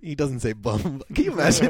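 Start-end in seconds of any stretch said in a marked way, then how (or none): 1.2-1.7 laughing it out, high; words bubbling through a laugh. Real, not acted. Laughing at her own joke.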